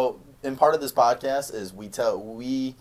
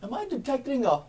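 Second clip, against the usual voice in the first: high-pitched, put-on inquiring voice; exaggerated polite questioning; imitated curious stranger; 10 semitones higher than usual. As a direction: mimic an intrigued stranger, voice lifted high.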